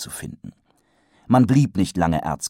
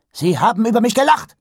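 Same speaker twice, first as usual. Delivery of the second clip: higher, louder and fast, spat out; angry outburst; 7 dB louder, faster than usual. A narrator's reading. The Patrician angrily bursts out to Wonse, high and fast. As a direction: burst out in indignation. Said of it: "stieß er hervor".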